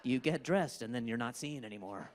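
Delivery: normal talking voice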